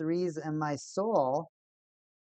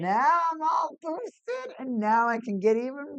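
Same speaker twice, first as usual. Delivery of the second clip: gloating villain; 9 semitones higher than usual. Acted. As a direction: crow over the opponent.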